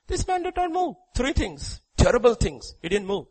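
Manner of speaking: usual speaking voice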